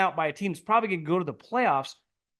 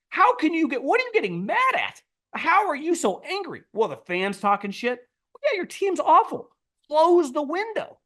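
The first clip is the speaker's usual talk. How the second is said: high, louder scoffing questions; incredulous mock rant; 11 semitones higher, 3 dB louder than usual. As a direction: fire the questions up high in disbelief. Mocking angry owner again.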